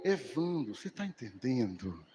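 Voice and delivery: imitating the senior pastor's hushed question; pastor Sandro. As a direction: drop the voice low and lean in. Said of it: "Aí ele".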